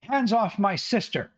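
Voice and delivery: broom-waving woman berating the adventurers, high and shrill-voiced scolding; angry village woman. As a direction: scold them like an irate housewife with a broom. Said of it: "and she says".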